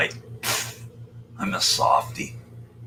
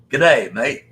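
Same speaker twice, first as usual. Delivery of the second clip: put-on Australian accent; 3 semitones higher, 5 dB louder than usual. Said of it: "there's the Australian guy".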